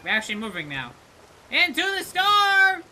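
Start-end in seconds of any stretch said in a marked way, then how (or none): 1.5-2.8 higher, slow heroic call; dramatic cry. Pretend. Player steering ship into storm, dramatic cry. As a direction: proclaim it like a captain.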